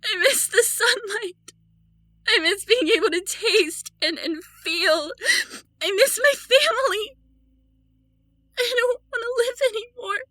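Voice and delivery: broken, weeping despair; tearful grief. Acted. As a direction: mourn it through tears. Grieving her lost life.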